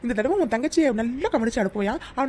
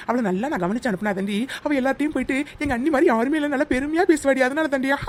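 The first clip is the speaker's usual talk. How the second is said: ordinary talk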